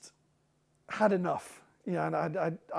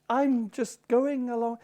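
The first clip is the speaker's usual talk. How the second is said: put-on slow, sing-song Western Highland accent; gentle lilting Highland Scots; 5 semitones higher, slower than usual. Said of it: "the Western Highland accent is lovely".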